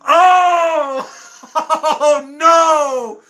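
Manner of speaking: high, loud, drawn-out oh no; loud mock-horrified reaction; amused outcry. Real, not acted. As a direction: wail it in delighted dismay. Reacting to a teasing comment.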